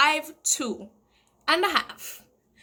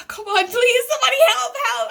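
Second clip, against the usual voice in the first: much higher and louder cry for help; retold scream for help; 11 semitones higher, 6 dB louder, faster than usual. Drowning at a public beach, loud high cry for help. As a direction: call out so somebody notices you. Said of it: "I was screaming".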